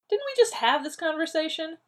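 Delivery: high, loud exasperation; annoyed. A narrator's reading. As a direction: snap it, fed up.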